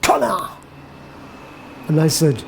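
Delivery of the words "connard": hammed-up angry French swearing; angry cursing. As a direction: curse in French, acting out her outburst. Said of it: "crying and cursing".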